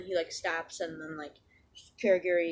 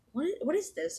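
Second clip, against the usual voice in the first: higher-pitched, stumbling question; confused surprise; 8 semitones higher than usual. Retold or imitated. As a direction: act out someone taken aback. Confused by his transformation.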